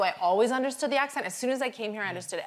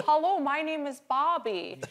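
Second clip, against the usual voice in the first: husband introducing himself; 7 semitones higher than usual. Retold or imitated. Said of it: "He introduced himself and goes".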